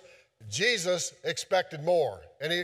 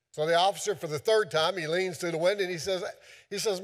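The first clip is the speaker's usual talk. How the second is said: ordinary talk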